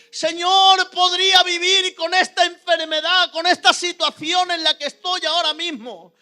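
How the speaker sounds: high, loud plea building to a full shout; impassioned retold shouting, peaking in anguish; shouting at God. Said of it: "empecé a gritarle al Señor".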